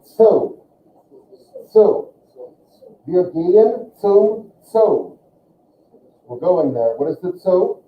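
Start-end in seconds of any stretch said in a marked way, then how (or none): 0.0-2.1 "so" said as a sharp "tso"; German Z as TS. Shown as a demonstration. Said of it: "but it's pronounced". 3.1-5.2 slow German, "zum Zoo" with sharp TS sounds. Shown as a demonstration. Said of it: "it has a kind of a harder attack".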